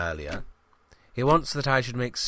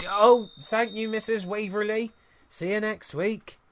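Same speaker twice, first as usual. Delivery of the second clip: much higher, sugary shopkeeper politeness; sweet customer voice; 13 semitones higher than usual. Acted. Greengrocer breaking off to thank a leaving customer, sugary and high. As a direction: switch to syrupy charm for the customer.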